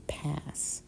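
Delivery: softly whispered refusal. Acted. Whispering pass to late-night offer. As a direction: breathe it, no voice.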